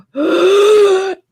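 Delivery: sharp, high, loud startled gasp; retold gasp. Girls seeing Darth Vader loom over them, imitated gasp. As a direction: gasp like a scared kid. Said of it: "they both go".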